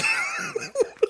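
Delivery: sudden high burst of laughter. Real, not acted. Bursts out laughing mid-rant.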